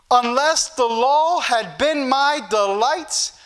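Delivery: slow, measured declamation of a verse; emphatic, drawn-out pulpit reading; scripture reading. Real, not acted. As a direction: read the verse out slowly and deliberately. Reads scripture to congregation.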